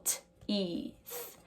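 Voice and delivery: three separate sounds, T, long E, soft th; sounded out. Shown as a demonstration. Teacher breaking teeth into sounds, sounded out slowly. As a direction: break it into T, ee, th with gaps. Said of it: "that makes the E sound".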